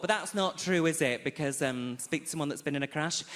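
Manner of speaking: normal talking voice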